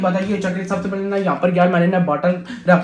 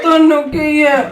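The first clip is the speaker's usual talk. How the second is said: laughing shout; 8 semitones higher, 8 dB louder, slower than usual. Real, not acted. Son breaking the prank.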